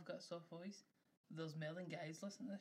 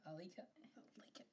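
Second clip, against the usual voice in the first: softer then whispered; fading to a whisper; 9 dB quieter than usual. Real, not acted. Teasing co-host, dropping into a whisper. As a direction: let it fade to a whisper. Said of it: "I like that".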